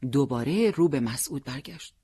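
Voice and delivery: ordinary delivery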